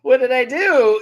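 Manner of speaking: loud, high mock what did I do; mock outrage. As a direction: throw up your hands in fake innocence. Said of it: "Olivia's like".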